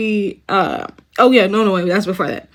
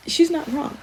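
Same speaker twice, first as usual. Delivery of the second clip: unvoiced whisper, higher; whispered aside; 9 semitones higher, 4 dB quieter than usual. Real, not acted. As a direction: confide it as a half-laughing whisper. Quiet aside after laughing.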